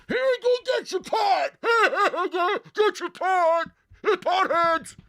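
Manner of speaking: high mocking shout out a car window; mocking drivers. Host imitating drivers jeering at patients in line, high mock yell. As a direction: jeer from a passing car, slow and high. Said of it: "people driving by saying".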